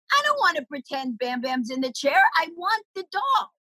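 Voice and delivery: put-on rising to a high, childish whine on the last words; whiny little kid. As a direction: play yourself at three, begging for the doll. Three-year-old whining to mom.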